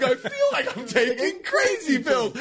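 high, laughing, exasperated Mugatu quote; Mugatu impression. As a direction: play the frantic fashion villain. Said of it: "it reminded me of Mugatu".

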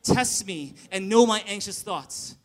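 reading aloud together, loud; loud group reading. Real, not acted. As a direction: read scripture out loud with the crowd. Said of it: "Read it in a loud voice with me".

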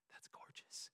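breathless whisper of admiration; whispered awe. Retold or imitated. Pastor admiring a listing late at night, whisper.

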